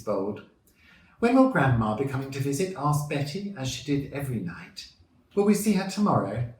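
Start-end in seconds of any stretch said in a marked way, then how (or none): 1.2-2.7 lightly voiced eager child, higher and louder; child asking. A narrator's reading. Betty asks her parents about Grandma's visit, as an eager child. 5.3-6.5 lightly voiced hopeful child, much higher; child asking. A narrator's reading. Betty asks her mother about seeing Grandma, as a hopeful child.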